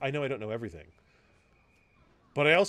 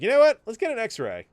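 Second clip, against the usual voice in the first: helpful doctor; 10 semitones higher, 11 dB louder than usual. Retold or imitated. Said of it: "He was like".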